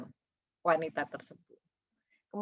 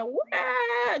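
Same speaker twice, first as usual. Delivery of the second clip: high, drawn-out imitated wail; long high-pitched "waaah" of fake bawling; mock wailing cry. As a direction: wail like a grieving widow, hammed up. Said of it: "sedang menangis meraung-raung".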